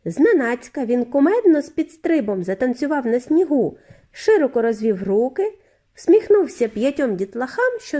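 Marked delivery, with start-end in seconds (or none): none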